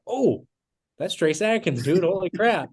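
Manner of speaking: starstruck gasp. Retold or imitated. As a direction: gasp out his name, thrilled. Starstruck seeing Trace walk out.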